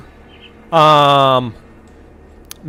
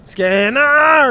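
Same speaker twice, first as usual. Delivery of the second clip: a higher, louder cartoon yell of Skinner!; Simpsons-style yell; 9 semitones higher, 5 dB louder than usual. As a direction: bellow the name like the cartoon principal. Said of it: "how does Simpson say it".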